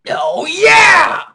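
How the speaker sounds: loud, high-pitched whoop; big gleeful "yes" yell; excited yell. Acted. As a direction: shout it out with glee. Excited at time travel idea.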